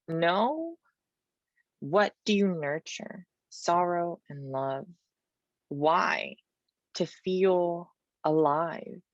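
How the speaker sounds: measured, dramatic poetry recital; poem reading. Acted. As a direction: read each line as a charged question and answer.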